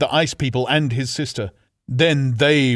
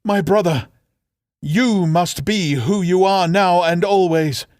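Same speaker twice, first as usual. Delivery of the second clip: solemn farewell; 6 semitones higher, 4 dB louder than usual. Acted. Sister's solemn farewell speech.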